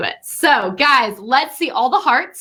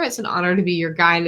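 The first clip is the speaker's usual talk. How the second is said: ordinary delivery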